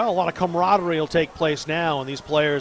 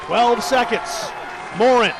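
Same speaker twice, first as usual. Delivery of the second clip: builds to a loud, higher call on the incompletion; excited play-by-play; 4 semitones higher, 3 dB louder than usual. Real, not acted. Announcer calling a desperate final pass, building excited shout. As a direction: ride the play to its peak, slowing on the throw.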